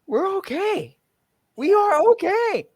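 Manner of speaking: squeaky high voice. Real, not acted. Squealing reassurance.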